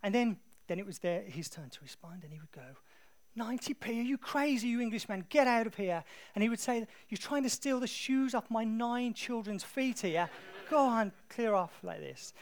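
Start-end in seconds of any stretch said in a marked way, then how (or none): 3.4-6.0 loud, high mock outrage; hammed-up indignant market trader; very animated. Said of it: "it would all be very animated". 7.1-11.8 loud, slow mock indignation; hammed-up wounded haggler, pitched high; very animated. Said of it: "it would all be very animated".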